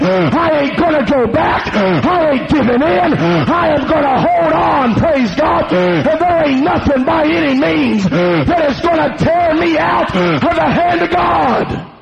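high, driving preacher's shout; climactic preaching. Acted. Preacher vows to hold on to God, climactic shouted preaching. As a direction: build to a held, defiant peak.

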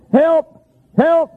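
high, short imitated distant holler; hollered cry for help. Hunter lost at night calling out, imitated high holler. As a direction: call out high as if lost in the woods. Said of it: "I heard somebody hollering".

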